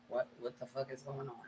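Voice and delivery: barely audible, baffled whisper; whispered thought. Retold or imitated. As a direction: whisper it to yourself while eavesdropping. Her hushed thought while overhearing.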